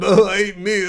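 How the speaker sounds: a quick dopey caveman line; lightly voiced caveman. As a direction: drop into the dumb meat eater for one line. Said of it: "kind of a caveman voice".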